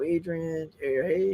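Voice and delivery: ordinary talk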